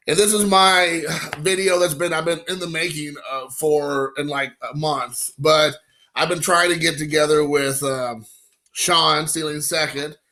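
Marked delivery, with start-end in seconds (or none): none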